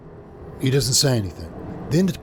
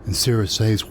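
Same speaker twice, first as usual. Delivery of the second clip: ordinary delivery, nothing marked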